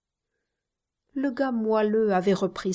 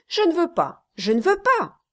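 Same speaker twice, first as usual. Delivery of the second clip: rising desperate cry; 10 semitones higher, 4 dB louder, faster than usual. Acted.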